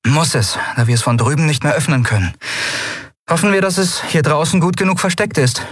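brisk captain's promise; Endriel, promising. A narrator's reading. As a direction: reassure them quickly. Endriel explains.

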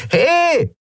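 very high-pitched yell; calling out. A narrator's reading. Woman at the door to the pirates, yelling. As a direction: shout for their attention. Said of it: "rief die klapprige Frau an der Tür wieder".

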